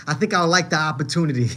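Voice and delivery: real amused chuckle around the line; laughing. Real, not acted. Streamer admits he would enjoy getting even, laughing. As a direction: chuckle through the words. Amused by own vengeful thought.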